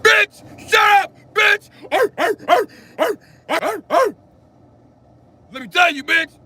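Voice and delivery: high, loud, slow screaming then barking. Shown as a demonstration. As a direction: scream then bark like a dog. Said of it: "just be like".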